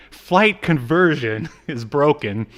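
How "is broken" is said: normal talking voice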